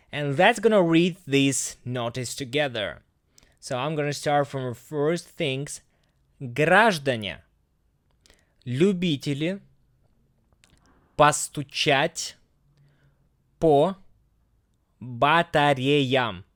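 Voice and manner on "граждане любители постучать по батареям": slow, word-by-word Russian reading; slow careful reading. Shown as a demonstration. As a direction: read it slowly, pausing between words. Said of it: "And again, just a little bit faster".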